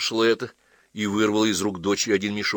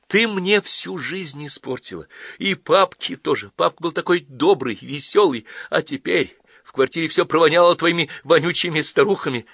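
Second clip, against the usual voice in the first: high-pitched bitter accusation; hurt, angry outburst; 9 semitones higher, 5 dB louder than usual. Acted. Daughter blames mother for ruining her life, angry. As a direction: pour out the grievance at a raised pitch.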